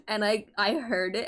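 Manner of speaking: normal talking voice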